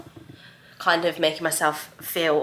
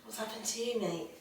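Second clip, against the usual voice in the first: hushed, tender and a bit higher; soft cooing; 4 semitones higher, 13 dB quieter than usual. Real, not acted. Cooing over the baby.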